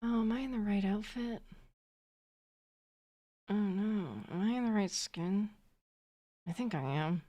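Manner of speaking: soft, breathy Jennifer Coolidge impression; Jennifer Coolidge drawl. Streamer to chat, a light Jennifer Coolidge purr. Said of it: "talking like Jennifer Coolidge".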